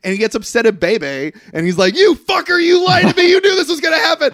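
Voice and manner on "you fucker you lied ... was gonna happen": high, loud outraged yelling; furious acted shout. Damon confronting Bebe after losing, acted furious shout. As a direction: explode in accusation at full voice. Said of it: "Damon gets all pissed".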